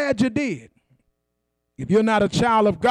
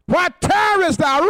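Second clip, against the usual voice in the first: higher, urgent preacher's command; 7 semitones higher than usual. Retold or imitated. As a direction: urge him up like the old preacher. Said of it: "The preacher said".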